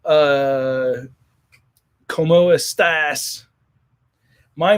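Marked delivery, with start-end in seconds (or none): 0.0-3.4 put-on slow, clunky gringo Spanish in a thick American accent; mock American-accented Spanish. Mocking Americans who try to speak Spanish, in a heavy American accent. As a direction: butcher the Spanish like an American tourist, slowly. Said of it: "it's the American accent, you know, speaking Spanish".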